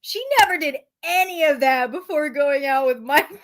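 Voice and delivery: ordinary talk, nothing marked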